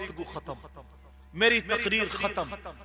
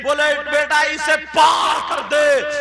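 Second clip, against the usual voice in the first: rising preaching; 7 semitones higher, 8 dB louder, slower than usual. Acted. Climax of the story.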